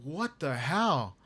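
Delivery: slow, stunned what the hell; retelling a stunned look at the coach, slow and disbelieving; slow disbelief. Retold or imitated. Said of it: "I looked at the coach and I'm like".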